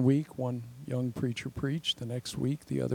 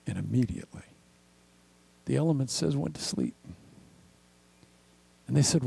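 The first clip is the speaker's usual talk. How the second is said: usual speaking voice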